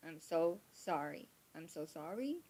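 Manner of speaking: ordinary delivery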